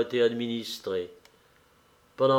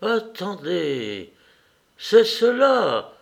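slow, rising to a high, louder revelation; sudden realisation; 6 semitones higher, 4 dB louder, much slower than usual. A narrator's reading. Dying man as all becomes clear, slow wonder. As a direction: hold back, then lift as it dawns. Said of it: "comme si tout s'était éclairé pour lui".

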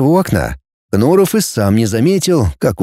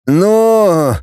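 higher, long stretched syllable; drawn-out word; 7 semitones higher than usual. A narrator's reading. Глеб begins his reply, stretching it out. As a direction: drag the one word out. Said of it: "затянул Глеб".

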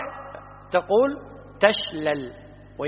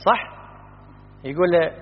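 ordinary talk

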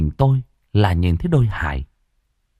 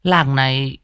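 put-on higher, slowing old grandmother voice; grandmother ominous; 5 semitones higher than usual. A narrator's reading. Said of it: "Bà nội ngồi bên hiên cũng nói xen vào".

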